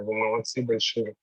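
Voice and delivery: ordinary delivery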